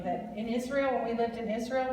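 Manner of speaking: normal talking voice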